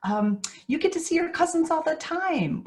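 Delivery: high, quick, sing-song adult cheer; brightly steer a child's attention elsewhere; cheery distracting parent. Parent distracting a toddler with forced cheer. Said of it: "So distract is saying things like".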